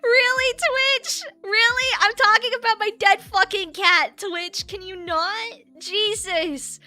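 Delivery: indignant rant. Real, not acted. To Twitch and Bezos about her grief, an indignant loud rant. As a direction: tell Twitch off, fed up. Ranting at Twitch over monetization.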